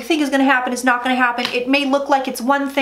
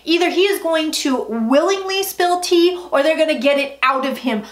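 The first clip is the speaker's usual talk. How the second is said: normal talking voice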